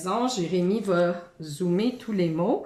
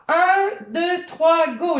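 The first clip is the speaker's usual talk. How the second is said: excited countdown; 6 semitones higher, 4 dB louder, slower than usual. Acted. Countdown starting the flyswatter game.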